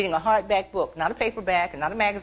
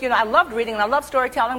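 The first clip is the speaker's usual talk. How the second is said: ordinary delivery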